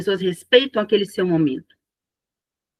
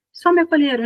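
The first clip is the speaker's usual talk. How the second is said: ordinary talk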